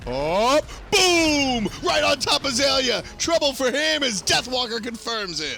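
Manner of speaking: loud, high play-by-play yell; excited shout. Real, not acted. Big play shouted by the caster.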